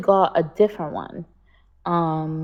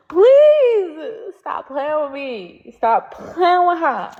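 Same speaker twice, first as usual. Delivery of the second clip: mock pleading; 7 semitones higher, 3 dB louder, much slower than usual. Pleading with viewers about the wig.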